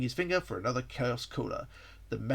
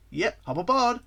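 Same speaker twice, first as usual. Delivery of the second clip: higher, louder hero's call; hearty shout; 9 semitones higher, 7 dB louder than usual. Acted. Sonic calls the guest onto the plane, hearty raised shout. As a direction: call out an invitation to climb on. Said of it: "Sonic shouted".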